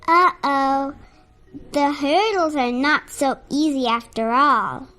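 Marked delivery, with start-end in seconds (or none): none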